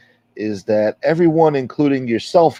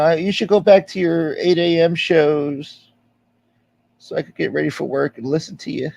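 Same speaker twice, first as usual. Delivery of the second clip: imitating Minnie; 6 semitones higher than usual. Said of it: "That sounded kinda like James Proctor".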